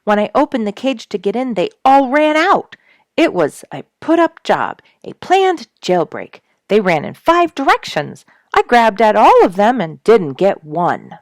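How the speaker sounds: high, loud tumbling report; breathless excited. A narrator's reading. Duke telling the others from the tree, loud and excited. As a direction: blurt it out all in a rush. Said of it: "he called down breathlessly".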